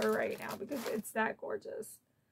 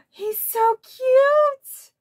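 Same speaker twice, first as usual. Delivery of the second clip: much higher and louder, slow gushing squeal; excited squeal; 13 semitones higher, 13 dB louder, much slower than usual. Real, not acted. Unboxing the Max pin she wanted, squealing with excitement. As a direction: squeal over the gift.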